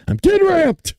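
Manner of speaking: sudden high loud burst of anger; getting ramped up. Real, not acted. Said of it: "I'm getting pissed off".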